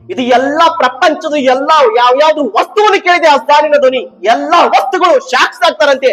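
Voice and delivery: high, loud sermon climax; full-force preaching. Real, not acted. Preacher declaring that all creation will bear witness, full-force preaching. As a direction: proclaim it urgently to the whole hall.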